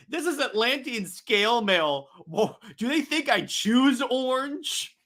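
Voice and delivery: high-pitched, indignant protest; offended hero, rising to a slow, incredulous finish; Aquaman. Acted. Aquaman objects to remark on his costume, high indignation. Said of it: "even Aquaman's like".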